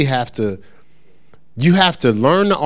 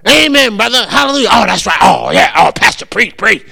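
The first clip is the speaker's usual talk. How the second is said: quick, hammed-up amen-corner calls; signifying churchgoer; 6 semitones higher, 4 dB louder than usual. Imitating congregation calling out to the pastor, animated signifying. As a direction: egg the preacher on with punchy shouts. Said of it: "They may not be signifying through the whole service".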